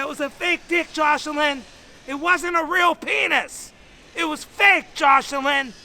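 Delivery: loud, high pleading shout; urgent yelling. Retold or imitated. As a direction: yell the explanation over his screaming. Yelling to calm the screaming kid.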